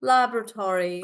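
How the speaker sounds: laboratory as LAB-ruh-tor-ee; American pronunciation. Shown as a demonstration. Teacher repeating the American version, laboratory with full tory. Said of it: "So Tory, like that".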